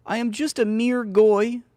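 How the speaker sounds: hammed-up begging to be accepted; mock pleading. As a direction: grovel in a put-on high voice.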